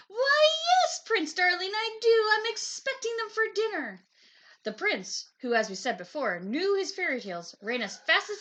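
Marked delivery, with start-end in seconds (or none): 0.1-4.1 a high, loud, syrupy witch voice; sugary witch. A narrator's reading. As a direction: gush sweetly as if the prince were a treat. Gingerbread witch gushing.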